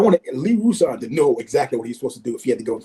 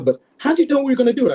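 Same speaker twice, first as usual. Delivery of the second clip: baffled question; 11 semitones higher, 3 dB louder, faster than usual. Retold or imitated. Voicing Bill Neal.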